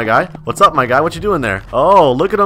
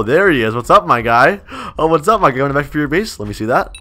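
ordinary delivery

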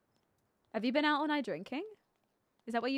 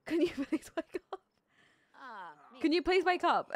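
laughing plea; 4 semitones higher than usual. Pretend. Pleading with passed-out sim, laughing.

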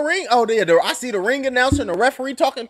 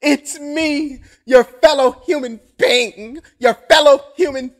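higher, drawn-out mock plea to stop; pleading opponent; 5 semitones higher, much slower than usual. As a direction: beg him to stop, slow and exaggerated. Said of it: "he gonna be like".

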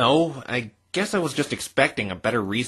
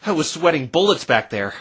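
ordinary delivery

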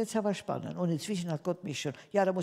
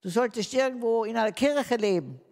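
higher, louder shouted scorn; shouting boss; 7 semitones higher, 5 dB louder than usual. Retold or imitated. The boss yelling at the pious employee, loud and high. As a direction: yell it at her like the angry boss. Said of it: "Dann hat er mir mal angeschrien".

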